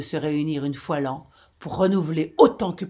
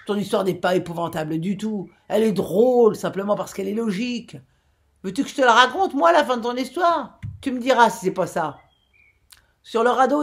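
mocking glee; 5 semitones higher, 6 dB louder than usual. A narrator's reading. Chanlieu eagerly mocks the captain.

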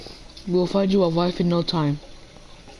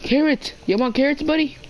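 high pet talk; 9 semitones higher than usual. Real, not acted. Offering carrots to the pig.

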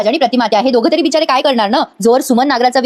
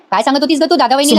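ordinary delivery

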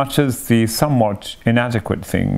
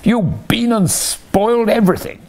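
crying out; 11 semitones higher than usual. Acted. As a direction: wail at Mole for spoiling it. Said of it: "cried Toad".

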